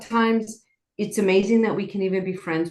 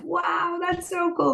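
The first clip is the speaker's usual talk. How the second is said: retold amazement; 10 semitones higher than usual. Telling how she reacted to the woman's technique, awed. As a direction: re-enact being wowed, pitch lifted right up. Retells own reaction to yawning story.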